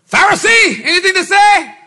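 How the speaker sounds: loud, high taunting challenge; demanding an answer from the Pharisee; challenging shout. Acted. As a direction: call them out, loud. Preacher challenges imagined Pharisee.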